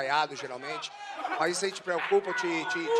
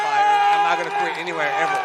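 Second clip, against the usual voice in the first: defiant vow; 8 dB louder than usual. Real, not acted. Interpreter echoing champion's vow.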